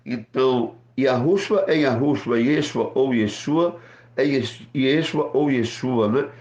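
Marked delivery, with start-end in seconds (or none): none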